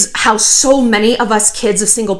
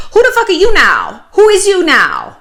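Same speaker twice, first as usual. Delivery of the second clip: imitating a teen's put-on street accent, much higher and louder; mock her defiant made-up accent; 9 semitones higher, 4 dB louder than usual. Retelling the teen snapping at her absent father, in a put-on accent. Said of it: "In her weird accent that she just made up".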